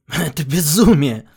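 loud scornful outcry; scoffing exclamation. A narrator's reading. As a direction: scoff at the madness. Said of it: "усмехнулся тот".

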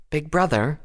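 put-on high, girlish plea to a brother; quiet princess. A narrator's reading. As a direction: gently check your brother in a high voice. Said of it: "Luna said quietly".

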